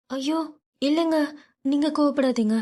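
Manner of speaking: a wife pleading with her angry husband not to get angry; soothing anxious wife. A narrator's reading. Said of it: "சமாதானப்படுத்திக் கொண்டிருந்தார்".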